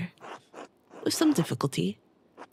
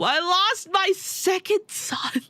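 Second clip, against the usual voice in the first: grieving wail; 11 semitones higher, much slower than usual. Acted. Grieving NPC speech.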